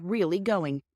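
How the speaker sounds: plain reading voice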